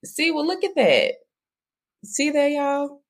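high, excited exclamation to the audience; delighted. Real, not acted. Host thrilled to find a shared hometown area, excited. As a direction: show them how thrilled you are.

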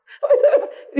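tawa lepas bernada tinggi; tertawa geli. Real, not acted. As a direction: tertawa spontan karena merasa senasib. Menertawakan kisah gurunya yang senasib. (tertawa geli, keras)

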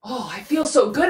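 ordinary delivery

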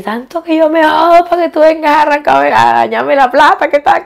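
angry retort, imitated from memory; retold anger. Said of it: "yo cogí una rabia y le dije".

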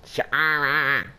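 squawky cartoon duck voice; Donald Duck voice. Shown as a demonstration. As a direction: quack the name like a cartoon duck. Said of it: "the closest thing I can do to the Donald Duck impression".

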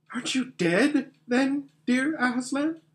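put-on high-pitched little girl's wondering question; young girl, amazed. Lucy asks Aslan if he is dead, amazed. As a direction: ask timidly in a girl's high voice.

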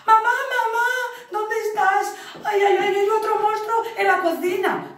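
put-on high, loud frightened kid voice; scared child calling. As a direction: cry out for mum in a panic. Child cat calling in fear.

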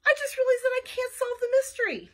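high, loud wail; dismayed cry. A narrator's reading. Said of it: "I cried".

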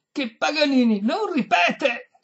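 high, slow, loud refusal; exasperated shout. A narrator's reading. Violinist to deaf lady, exasperated high shout. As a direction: hammer it out slowly and loudly.

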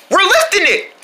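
high, strained cheer; excited triumph. Pretend. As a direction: call it out mid-lift. Group succeeds in lifting.

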